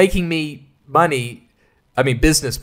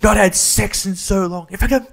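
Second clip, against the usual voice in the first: higher, slow put-on villain voice; cartoonish supervillain whine; mock Riddler; 5 semitones higher than usual. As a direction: ham up a horny Riddler confessing. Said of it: "Riddler's not like".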